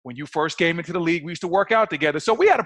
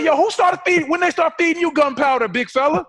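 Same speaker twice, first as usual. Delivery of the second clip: mock indignant call-out; 9 semitones higher than usual. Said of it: "And I said".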